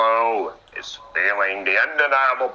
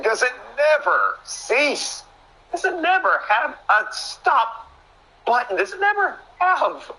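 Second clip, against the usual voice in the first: high, dramatic pleading questions; exasperated commentator outcry; 14 semitones higher than usual. Real, not acted. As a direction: wail in hammed-up disbelief at the endless beating. Commentator laments the endless beating.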